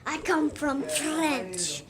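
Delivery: slow mock French accent; a kid's playful fake French accent. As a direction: put on a silly French voice for the puppet. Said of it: "since he was doing the French voice so much".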